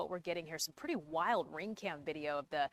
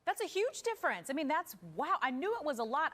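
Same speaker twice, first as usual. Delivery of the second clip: surprised; 9 semitones higher than usual. Real, not acted. Anchor reacts to big statistic.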